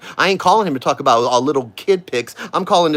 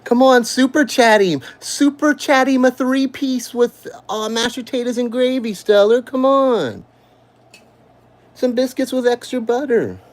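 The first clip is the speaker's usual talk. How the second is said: mock cheerleading; 9 semitones higher than usual. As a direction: egg them on to super chat food. Taunting viewers to super chat.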